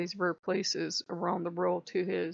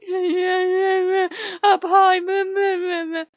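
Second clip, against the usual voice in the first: high, loud, bleating cry; exaggerated tearful whimper; mock whimpering; 12 semitones higher, 12 dB louder, slower than usual. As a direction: whine like a scared child, hammed-up. Acts own scared whimpering reply.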